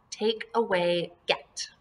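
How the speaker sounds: ordinary talk, nothing marked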